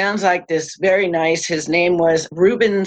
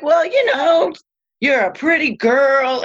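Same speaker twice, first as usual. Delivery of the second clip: higher, fussing mother voice; a mother fretting over her daughter; worried mom; 8 semitones higher than usual. Retold or imitated. Mom warning her daughter before the Bahamas trip, higher and fussing.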